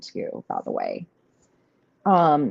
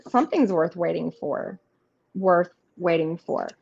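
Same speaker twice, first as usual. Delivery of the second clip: ordinary delivery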